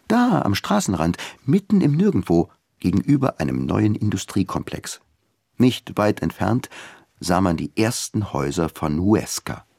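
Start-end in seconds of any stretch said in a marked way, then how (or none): none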